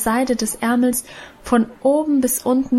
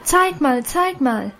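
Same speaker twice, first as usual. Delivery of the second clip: put-on higher, excited chorus of girls; excited shouting; 4 semitones higher, slower than usual. A narrator's reading. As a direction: crowd of girls clamouring to see. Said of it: "schrien die anderen Mädchen nun auch aufgeregt".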